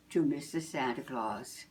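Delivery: usual speaking voice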